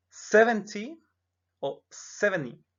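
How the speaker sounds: "seventy" first with a T, then as "seveni"; "seventy" with and without the T; T kept or dropped. Shown as a demonstration. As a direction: model both ways of "seventy". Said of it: "la T puede no sonar".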